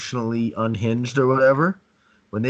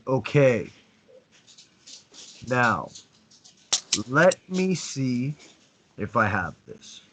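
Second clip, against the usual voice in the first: very slow, measured and controlled; slow strong demo; slower than usual. Shown as a demonstration. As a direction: drag each phrase out with calm command. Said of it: "notice in my voice and in the way that I'm speaking".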